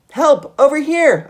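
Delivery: very high, loud call for help; desperate cry. A narrator's reading. Geronimo in the sea calling to the boat, desperate shout. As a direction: yell across the waves to be saved. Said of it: "I cried".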